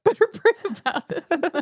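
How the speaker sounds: high, giggling mock voice; laughing repeat. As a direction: echo the joke through laughter. Repeating the bit while laughing.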